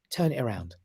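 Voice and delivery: hushed inner voice calmly instructing. Retold or imitated. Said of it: "the voice in my head, and it wasn't my voice".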